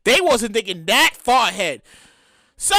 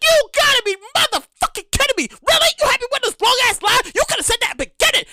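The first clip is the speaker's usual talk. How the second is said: higher, incredulous outrage at the cashier; exasperated rant; 11 semitones higher than usual. Retold or imitated. Customer to cashier after a long line, exasperated high-pitched rant. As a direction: fire it back, fed up after the long wait. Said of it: "And I'm like".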